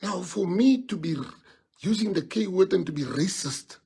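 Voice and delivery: ordinary talk, nothing marked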